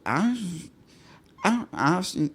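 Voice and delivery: put-on high, slurred drunk stammer. Podcaster shows how drunk the date became, slurred. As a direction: imitate someone suddenly too drunk to speak. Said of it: "they start slurring their speech".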